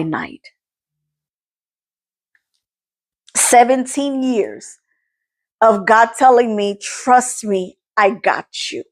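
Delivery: real, understated crying; tearful testimony. Real, not acted. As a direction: hold back tears between phrases. Tearful testimony, long pauses.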